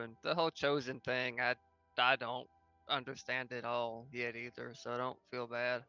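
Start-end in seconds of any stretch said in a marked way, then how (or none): none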